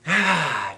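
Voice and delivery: big letting-go exhale; relieved sigh. Shown as a demonstration. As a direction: breathe the stress out audibly. Demonstrates letting stress go.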